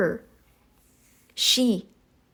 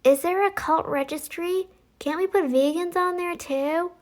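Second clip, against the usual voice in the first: mocking commenter; 7 semitones higher than usual. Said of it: "there was one that was like".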